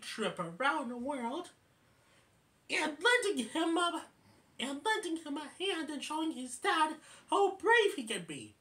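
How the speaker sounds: high, eager chipmunk persuasion; Alvin. Retold or imitated. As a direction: talk him into it at a high pitch. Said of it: "And then Alvin says".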